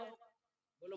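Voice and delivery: ordinary delivery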